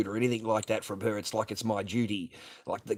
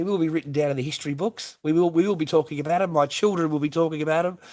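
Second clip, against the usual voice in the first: loud, high and fast, hyped up; excited boast; 5 semitones higher, 8 dB louder than usual. Real, not acted. Streamer boasting to his viewers, loud and hyped. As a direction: crow about it to the chat.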